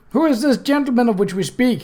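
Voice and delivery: Cutwell yelling high over the wind; yelled over wind. Acted. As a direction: call it out above the gale. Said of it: "he yelled".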